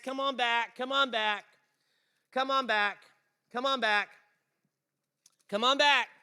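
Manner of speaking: loud, high call, over and over; urgent repeated shout. Acted. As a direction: call him back across the crowd. Calling the paralyzed man back.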